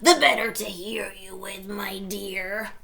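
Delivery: croaked whisper; croaky wolf whisper. Acted. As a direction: croak it under your breath. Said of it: "croaked the wolf".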